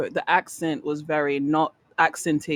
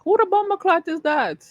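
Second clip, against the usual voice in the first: put-on African accent; 13 semitones higher than usual. Reacting to African names.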